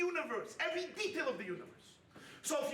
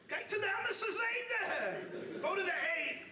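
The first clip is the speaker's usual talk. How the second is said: slow laughing punchline; 7 semitones higher, slower than usual. Acted. Joking punchline, slowed.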